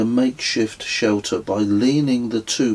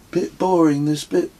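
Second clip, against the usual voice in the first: higher, lightly voiced bored friend; just waking up and bored; bored Kez; 7 semitones higher than usual. A narrator's reading. Kez complains on waking, higher bored voice.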